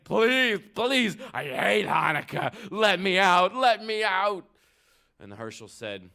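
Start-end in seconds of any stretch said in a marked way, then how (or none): 0.0-4.4 high, loud, desperate pleading; begging goblin. Acted. Goblin begging to get free of the jar, loud high pleading. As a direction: plead loudly as a goblin in a panic. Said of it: "The, the goblin was begging".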